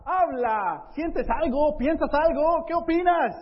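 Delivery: building from firm to loud and high; urging Jesus. Acted. Jesús urges Felipe to speak. (Jesús anima a Felipe a hablar, cada vez más fuerte)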